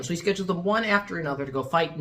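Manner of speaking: ordinary delivery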